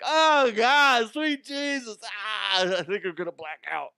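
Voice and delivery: high, strained cry of pain; exaggerated high-pitched agony; mock agony. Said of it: "Not like that".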